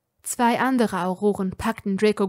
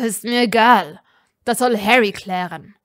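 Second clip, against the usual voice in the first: louder, snapping scold; 8 dB louder than usual. A narrator's reading. Ron scolding Hermine, angry and loud. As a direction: bite it off angrily. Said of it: "schimpfte Ron".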